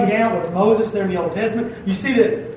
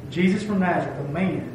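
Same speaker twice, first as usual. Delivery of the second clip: usual speaking voice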